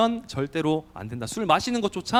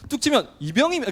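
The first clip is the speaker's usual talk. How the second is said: confident shout; 8 semitones higher, 4 dB louder than usual. Shown as a demonstration. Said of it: "다 크게 목소리 딱 자신감 있게".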